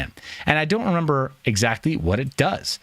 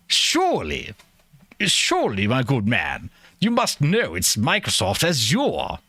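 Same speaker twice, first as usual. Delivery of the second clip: mock British accent; slower than usual. Streamer mocking the Azure correction, exaggerated British accent. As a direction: play a fussy Brit insisting on a-ZURE. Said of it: "in that accent".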